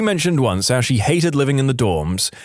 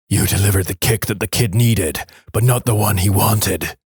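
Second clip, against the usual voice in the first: theatrical villain monologue; 3 semitones lower than usual. Acted. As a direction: play a menacing villain, drawing the words out. Parody villain monologue in put-on voice.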